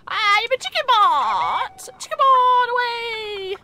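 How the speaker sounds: mock heroic announcement. Playful chicken bot announcement.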